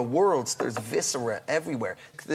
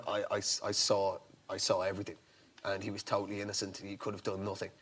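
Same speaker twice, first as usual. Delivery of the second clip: earnest quiet insistence; 7 semitones lower, 10 dB quieter, faster than usual. Retold or imitated.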